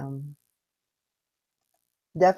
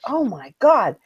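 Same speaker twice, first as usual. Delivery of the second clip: wife's alarm; 7 semitones higher, 7 dB louder than usual. Retold or imitated. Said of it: "she says".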